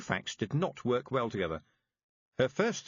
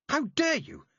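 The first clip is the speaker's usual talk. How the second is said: outraged shout; 5 dB louder than usual. A narrator's reading. Repeated, escalating fury.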